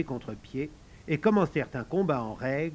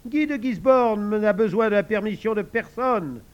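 high, loud proud retort; boy playing the villain knight; haughty Guy of Gisborne; 7 semitones higher, 6 dB louder than usual. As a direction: sneer back like the knight. Joe plays Guy of Gisborne.

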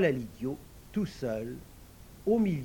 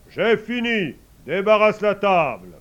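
put-on majestic old man ordering; 6 semitones higher, 13 dB louder than usual. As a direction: bark out the order to the servant.

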